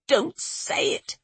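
slow, high, whispered warning; frightened whisper. A narrator's reading. Maid forbids naming the curse, whispered in fear. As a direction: hush them urgently, drawing it out.

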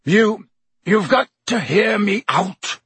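desperate croak. A narrator's reading. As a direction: beg him to listen. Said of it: "he croaked".

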